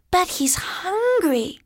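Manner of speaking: high, drawn-out protest; pleading. Acted. Jack protesting to Auntie Min about the owl, pleading. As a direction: plead on someone's behalf, stretching the words.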